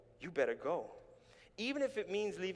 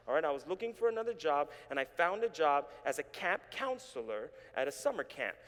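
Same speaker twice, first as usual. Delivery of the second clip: ordinary delivery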